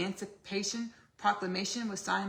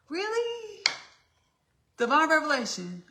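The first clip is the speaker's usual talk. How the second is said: loud, high-pitched astonishment; big high exclamation; sudden revelation; 8 semitones higher, 9 dB louder than usual. Real, not acted. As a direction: burst out as if struck by a revelation. Sudden realisation mid-list.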